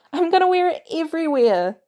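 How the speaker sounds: put-on a thrilled boy gushing over a gift; excited kid. Boy thanking Natalia for the jacket, thrilled.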